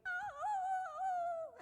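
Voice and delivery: high loud sung howl; coyote howl. Retold or imitated. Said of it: "A coyote".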